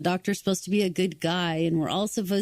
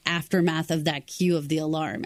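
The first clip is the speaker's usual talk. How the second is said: usual speaking voice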